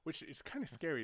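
ordinary talk

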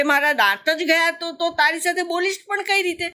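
put-on high, worried questions from a scared child; fearful little croc. A narrator's reading. Little croc fears losing his teeth and frets to mother, high anxious voice. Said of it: "મગરું બીતા બીતા બોલ્યો".

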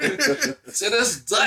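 slow, laughing country accent. Real, not acted. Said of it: "your country accent is kicking in".

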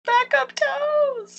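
high, slow, drawn-out disbelief; incredulous. Real, not acted. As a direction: stretch it out in disbelief. Incredulous at quote.

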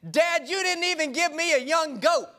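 whiny complaint. Retold or imitated. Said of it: "And he said".

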